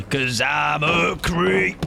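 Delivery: slow, high creepy drawl; hammed-up movie creep. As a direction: creep it out slowly. Gasp then creepy line.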